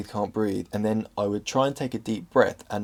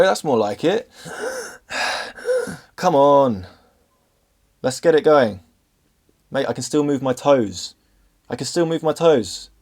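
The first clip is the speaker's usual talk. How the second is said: higher, louder taunts building to shouts; cocky challenge rising to a yelled "kill me"; defiant goading; 5 semitones higher, 5 dB louder than usual. Retold or imitated. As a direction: goad the attack, dare it to do its worst. Daring the panic attack to worsen.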